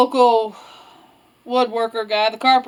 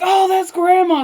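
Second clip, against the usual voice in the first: put-on high, eager little-girl exclamation; excited realisation; 7 semitones higher than usual. Little Red recognising Grandma's voice, excited. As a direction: burst out with it, pitched up.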